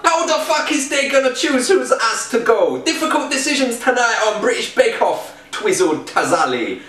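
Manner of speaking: hammed-up gangster read over the music; reading a baking headline as a gangster; mock gangster. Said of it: "in gangster".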